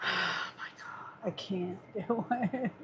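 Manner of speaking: slow, voiceless, worn out; defeated whisper. Real, not acted. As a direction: breathe it out in slow despair. Can't even restart the phone.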